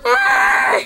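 high pretend scream; screaming at a spider. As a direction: shriek up high in mock terror.

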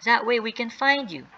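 mild mock scream. James guiding Sally's rescue, calling out. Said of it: "James screamed".

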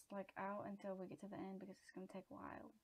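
usual speaking voice

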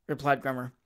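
ordinary delivery, nothing marked

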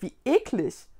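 mildly revolted remark; a touch of disgust. A narrator's reading. Vicky reacts to snotty handkerchiefs, mildly disgusted. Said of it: "machte Vicky angewidert".

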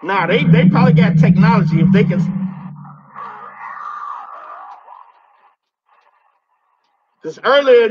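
loud, bold callout to an unseen ghost; loud taunting. Real, not acted. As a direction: project it at the demon, challenging. Taunting demons in a haunted spot.

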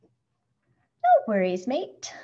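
slow, put-on Australian accent. A narrator's reading. Schubert reassures the beetle, Australian accent. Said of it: "replies in an Australian accent".

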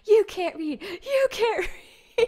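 high, loud shout breaking into laughter; yelling back. Retold or imitated. [name] yelling at the drunk man while leaving the bus, shouting. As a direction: hurl it over your shoulder, laughing. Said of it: "I was yelling back at him".

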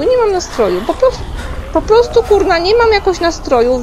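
high, loud complaining cry; loud whining protest. Real, not acted. Gamer refusing the chase, loud whiny protest. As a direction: protest loudly like you really can't take it.

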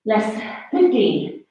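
ordinary talk, nothing marked

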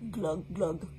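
mock glugging sound; playful glug glug; fish glug. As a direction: gulp like a fish.

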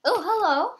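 loud, high, bright hello; excited greeting. Pretend. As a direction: greet them with a burst of delight. Greeting passenger at the gate.

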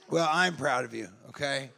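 lightly voiced mom reassuring son; mock tender mom. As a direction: soothe him like a mother.

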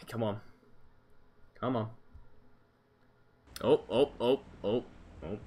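ordinary talk

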